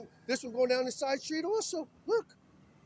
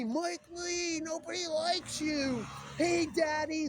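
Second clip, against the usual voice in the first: mock taunt; 5 semitones higher, slower than usual. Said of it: "they'll scream my name out the window".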